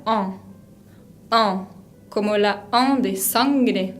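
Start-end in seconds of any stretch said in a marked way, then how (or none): 0.1-1.6 en said slowly through the nose, no n; nasal en. Shown as a demonstration. Teacher demonstrating the nasal en to the class. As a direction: hold the nasal en. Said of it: "se pronuncia".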